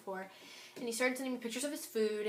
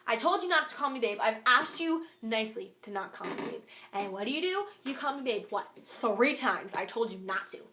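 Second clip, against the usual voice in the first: louder, emphatic retold scolding; indignant; 4 dB louder than usual. Retelling her reply to being called babe, indignant. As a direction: scold them with hard-hit words, fed up.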